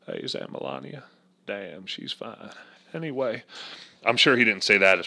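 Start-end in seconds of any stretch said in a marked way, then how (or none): none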